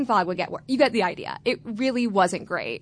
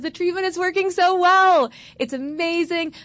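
high, bright and loud with delight, the doctor thrilled; beaming good news; 9 semitones higher, 3 dB louder than usual. Retold or imitated. Doctor breaking wonderful news to patient, thrilled. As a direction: announce it like the best news ever. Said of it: "huge smile on her face".

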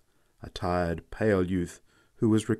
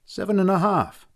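sharp retort; 10 semitones higher, 4 dB louder than usual. A narrator's reading. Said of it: "answered Herr Erchardt shortly".

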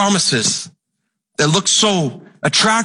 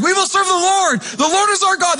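much higher, eager crowd voice quoting the people; a congregation's overeager pledge, pitched up high; the people's eager reply; 12 semitones higher than usual. Retold or imitated. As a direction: play the people promising too quickly. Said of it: "They're like".

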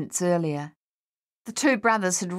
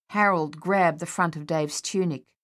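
ordinary delivery

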